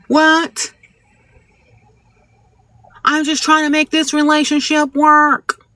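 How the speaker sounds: high, whiny mock of a demanding partner; exaggerated high voice mocking a needy woman; mocking nagging girlfriend. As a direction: parody a clingy girlfriend who wants control. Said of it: "so many women are like".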